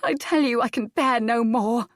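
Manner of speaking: high, loud despairing cry; anguished outburst. Acted. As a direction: break out in anguish. Adair's grief overflows.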